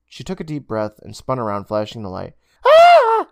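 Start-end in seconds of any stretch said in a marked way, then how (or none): 2.6-3.3 high loud startled yell; startled shout. Acted. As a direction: yelp in fright. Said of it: "someone shouted".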